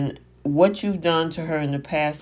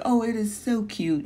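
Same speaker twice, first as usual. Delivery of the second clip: put-on high, cutesy baby voice; 7 semitones higher than usual. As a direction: coo it like a little girl. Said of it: "in her little baby voice".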